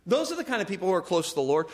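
fast, brisk restatement; quick repeat. Shown as a demonstration. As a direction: rattle it off quickly. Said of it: "repeats the line faster".

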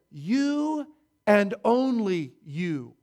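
"you" hit hard both times, slowly; stress on you. Shown as a demonstration. Preacher drives home the point to the church, stressing "you". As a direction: lean on each "you" in "You and only you". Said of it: "in the emphatic position".

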